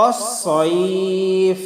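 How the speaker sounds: অসয়ফ slow, ending on an airy ফ; breathy fa. Shown as a demonstration. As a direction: let air escape on the final ফ. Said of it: "মুখ দিয়ে হালকা একটু বাতাস বের হয়".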